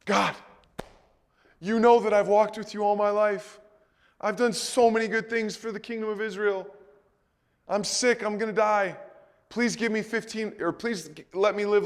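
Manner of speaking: high, earnest begging prayer; pleading king. Retold or imitated. Hezekiah, sick and dying, begs God in a high pleading voice. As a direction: beg God for your life from a sickbed.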